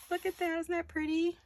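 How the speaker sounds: high, breathy with delight at a find; delighted gasp. Real, not acted. Crafter to viewers, gasping at a pretty find, delighted. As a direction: gasp and marvel at it. Spots a pretty paper piece.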